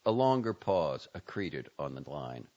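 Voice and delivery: usual speaking voice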